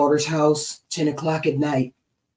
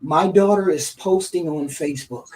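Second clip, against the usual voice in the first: ordinary talk, nothing marked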